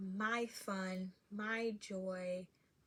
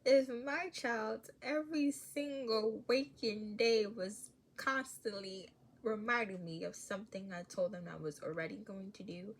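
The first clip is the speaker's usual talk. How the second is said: usual speaking voice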